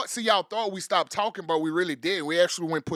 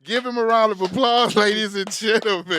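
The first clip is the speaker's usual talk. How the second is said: loud announcing; 8 dB louder than usual. Acted. Hype man closing intro.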